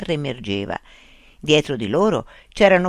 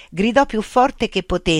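ordinary delivery, nothing marked

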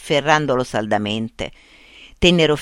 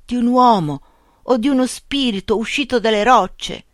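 lightly voiced blind villager, higher, halting; a hint of a frightened blind man, pitched up; broken old Spanish; 6 semitones higher than usual. A narrator's reading. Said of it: "in uno spagnolo ormai a stento riconoscibile". (cieco spaventato, voce leggermente più acuta)

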